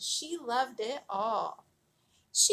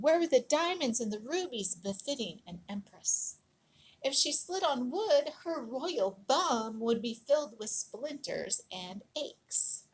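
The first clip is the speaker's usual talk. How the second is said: plain reading voice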